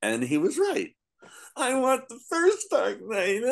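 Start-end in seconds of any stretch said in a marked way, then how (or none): 1.6-3.4 mock whining. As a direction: whine like a complaining fan, far up in pitch. Mimics complaining fans of the book.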